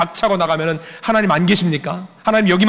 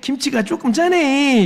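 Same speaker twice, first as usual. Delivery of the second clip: slow, much higher Jeolla drawl, lightly mocked; imitating Jeolla dialect; 9 semitones higher, much slower than usual. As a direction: savour the kimchi in a slow Jeolla accent. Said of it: "필리핀 분이 이야기합니다". (느리고 높은 전라도 사투리 흉내)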